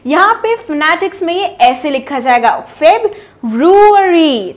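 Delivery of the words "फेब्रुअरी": फेब्रुअरी slowly with roo stretched; slow model pronunciation. Shown as a demonstration. Teacher slowly modelling फेब्रुअरी. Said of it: "यू खिंचेगा".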